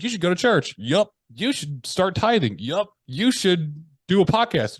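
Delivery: high mock mentor with quick yups. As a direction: play the eager mentor firing off advice. Mentor's rapid advice and replies.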